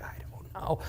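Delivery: soft unvoiced whisper. Onlookers answering each other in a hushed whisper. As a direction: shrug it off in a whisper. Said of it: "they're like".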